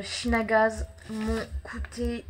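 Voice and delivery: ordinary talk, nothing marked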